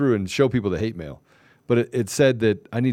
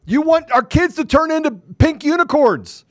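high, loud, drawn-out accusation; angry rant; 12 semitones higher, 5 dB louder than usual. Real, not acted. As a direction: raise the pitch sharply and denounce them. Political rant at opponents.

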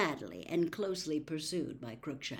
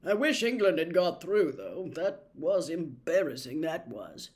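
louder, lightly voiced grumbling young man; Charlie's voice; 6 dB louder than usual.

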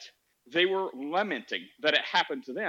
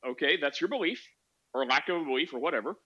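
ordinary delivery

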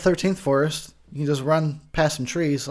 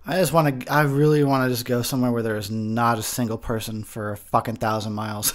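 ordinary talk

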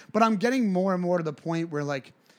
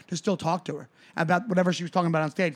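normal talking voice

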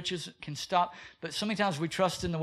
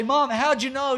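put-on kid caught out; 7 semitones higher, 6 dB louder than usual. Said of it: "I'd say".

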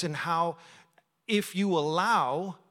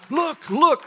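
excited exclamation; 11 semitones higher, 10 dB louder than usual. Retold or imitated. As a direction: imitate amazed onlookers, high and loud. Acting out astonished companions seeing rain.

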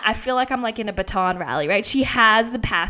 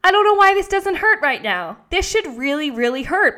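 loud, higher-pitched disbelief; amazed; 7 semitones higher, 5 dB louder than usual. Retold or imitated. Runner baffled that the injury doesn't hurt, amazed. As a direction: marvel out loud at it. Amazed at painless running mid-panic.

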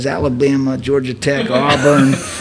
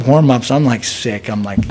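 normal talking voice

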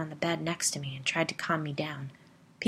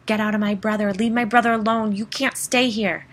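full-throated scream, much higher and louder; 5 semitones higher, 9 dB louder than usual. Retold or imitated. Brother screaming at the demon possessing Pete, loud scream. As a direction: scream at the thing inside him. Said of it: "I started screaming".

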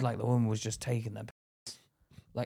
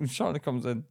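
ordinary delivery

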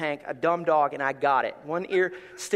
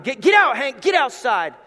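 loud urgent shout, pitched up; shouting at the dog; 6 semitones higher, 8 dB louder than usual. Retold or imitated. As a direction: chase the dog outside fast. Shooing the vomiting dog.

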